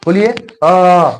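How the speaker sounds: अ held long and slow after a clear बोलिए; teacher demonstrating how अ sounds from the throat; slow pronunciation demo. Shown as a demonstration. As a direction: model the vowel अ slowly for the class. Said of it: "बोलिए ना अ".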